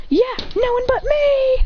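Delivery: high, drawn-out comeback; mock boastful cry. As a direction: stretch it out high, showing off in play. Acted boastful comeback in a story.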